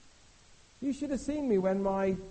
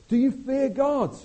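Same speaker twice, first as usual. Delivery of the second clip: booming challenge; 9 semitones higher, 9 dB louder, slower than usual. Acted. Preacher booms the question at his church, slow and loud. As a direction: Do you fear God? thundered slow and high.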